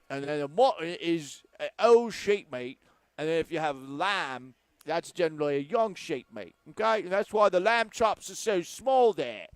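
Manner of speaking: high, loud hammed-up Cockney accent. Host explaining mutton to listeners, in a hammed-up Cockney accent. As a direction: explain sheep meat in a mock Cockney accent. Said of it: "this turned into a Cockney accent".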